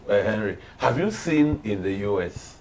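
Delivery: ordinary talk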